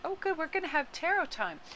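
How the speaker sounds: high, soft cat voice; voicing the cat. Voicing the cat, unimpressed by tarot time. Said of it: "now she's like".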